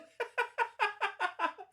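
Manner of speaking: a high burst of laughter. Real, not acted. Vlogger laughing at her own Elmo joke, high laugh. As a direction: crack up at your own gag.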